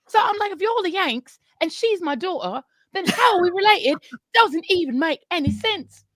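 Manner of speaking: high, exasperated mock British; put-on British accent. Shown as a demonstration. Fake Brit puzzles over her American daughter, high British accent. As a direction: protest in a hammed-up British accent. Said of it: "speaking in a British accent".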